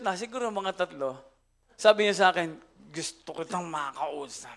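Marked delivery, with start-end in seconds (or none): 2.9-4.6 put-on low drunk quote. Drunk wife speaks low.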